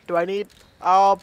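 usual speaking voice

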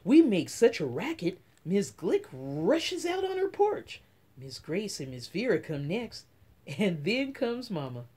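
ordinary delivery

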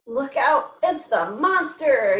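mock-alarmed yell for young listeners; mild storybook yell. A narrator's reading. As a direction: warn of a monster, playfully drawn out. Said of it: "yelled Little Yellow Dog".

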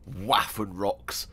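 Waffenrocks stretched out slowly, every syllable relished; savoring the word. Shown as a demonstration. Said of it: "I love the word Waffenrocks".

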